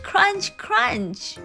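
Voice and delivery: plain reading voice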